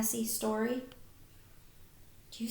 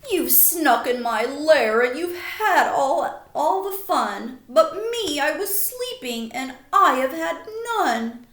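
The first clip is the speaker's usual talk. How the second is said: louder, complaining grumpy bear; whiny bear; 3 semitones higher, 9 dB louder than usual. Acted. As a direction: wail it like a sulky, hurt bear.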